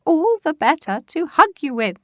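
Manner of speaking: high, loud granny impression; wolf pretends to be grandma; fake granny voice.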